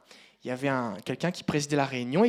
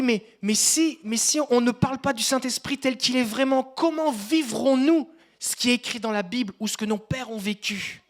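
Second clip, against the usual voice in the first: higher, slow, louder outcry; shouting in the dream; 8 semitones higher, 8 dB louder than usual. Retold or imitated. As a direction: shout it across the hall, protesting. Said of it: "je hurle, je crie".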